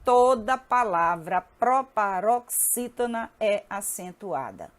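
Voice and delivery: drawn-out, chant-along pacing; slow drill to repeat. Shown as a demonstration. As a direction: lead the class through it slowly.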